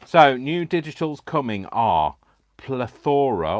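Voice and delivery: slow, loud grand announcement; drawn-out announcing. Acted. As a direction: savour each name slowly and loudly. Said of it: "playful drawn-out reading of names".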